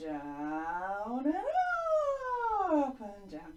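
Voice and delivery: long slide down on "down", swoop up on "up"; swooping demo for children to copy; voice tracing the line. Shown as a demonstration. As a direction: stretch the words into slow glides. Voice traces the line.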